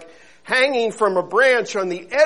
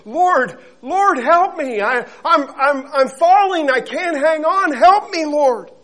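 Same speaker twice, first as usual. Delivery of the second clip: high, loud frantic begging; desperate plea; 10 semitones higher, 8 dB louder than usual. Acted. Falling man crying to God for help, frantic. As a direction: beg the Lord, you're slipping. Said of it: "the man said".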